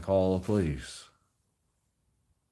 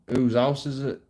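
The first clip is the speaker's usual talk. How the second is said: louder, higher officer's question; retold police, raised voice; police demand; 7 semitones higher, 8 dB louder than usual. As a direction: bark it as the cop. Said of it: "The police were like".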